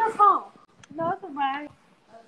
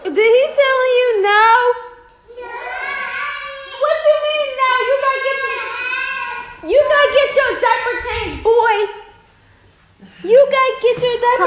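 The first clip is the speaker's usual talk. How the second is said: high loud coaxing; 7 semitones higher, 4 dB louder than usual. Real, not acted. Mother calling her toddler over for a diaper change, high and loud.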